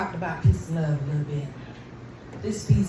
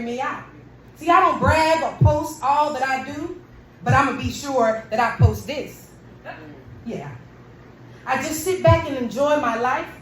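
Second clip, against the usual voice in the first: building, defiant spoken word, rising higher and louder; angry poem; 6 semitones higher, 3 dB louder than usual. Acted. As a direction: throw each how dare you at them. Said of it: "one of my angry pieces".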